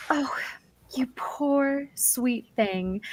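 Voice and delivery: slow, drawn-out pitying coo; condescending pity. Acted. Temple priestess to a bedraggled visitor, slow condescending pity. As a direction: pour on patronising sympathy, very slowly. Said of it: "their friendly condescension".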